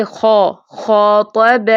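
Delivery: "خو، خاطب" recited slowly with a heavy, rounded "aw"-like long vowel; full-letter heavy vowel. Shown as a demonstration. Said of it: "پر حروف کے لیے ٹال کا". ("خو، خاطب" موٹی آواز میں کھینچ کر)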